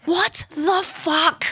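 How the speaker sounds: slow, high incredulous what the fuck; stunned disbelief. Retold or imitated. Retelling her shock at the stewardess to co-hosts, slow and high. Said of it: "I was like".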